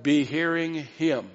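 "Be hearing him" drawn out slowly; slow emphatic rendering. Shown as a demonstration. Preacher giving the meaning to the congregation, slow emphasis. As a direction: spell out the command slowly, falling at the end. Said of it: "that's what this is saying".

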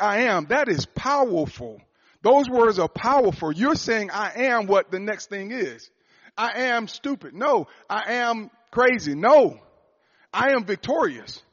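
high, loud, slow preaching; full-force preaching. Acted. Preacher to the congregation, building to a loud climax. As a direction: drive each I am home.

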